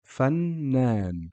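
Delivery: "Fannan" with a long held double n; doubled consonant demo. Shown as a demonstration. Teacher models the doubled n for students, deliberate. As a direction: stretch the n in "Fannan". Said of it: "You can hear the N sound or the noon pronounced twice".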